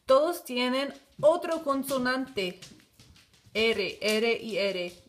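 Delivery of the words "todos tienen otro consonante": normal talking voice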